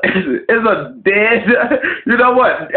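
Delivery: breaking into a high laugh mid-sentence; high-pitched, laughing through the words. Real, not acted. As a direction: crack up and lose the sentence. Cracks up mid-sentence about wrestlers.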